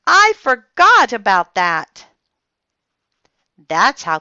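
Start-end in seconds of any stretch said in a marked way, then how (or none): 0.1-2.1 put-on loud, high, dismayed little girl; loud fretful cry; upset. A narrator's reading. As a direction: blurt it, upset with yourself. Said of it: "real upset".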